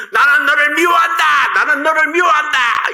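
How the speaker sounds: high, loud echoing shout. Retold or imitated. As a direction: call it back like the hills answering. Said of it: "이쪽에서 저쪽에서 막 들려오는".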